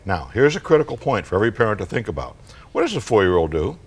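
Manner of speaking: ordinary talk, nothing marked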